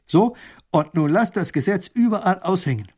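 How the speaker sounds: high, loud order; commanding. A narrator's reading. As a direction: send the scribe off briskly with an order. Ruler gives final order.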